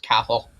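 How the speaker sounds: "Cathal" as CA-hul, T silent; Irish pronunciation. Shown as a demonstration. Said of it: "more how it's pronounced".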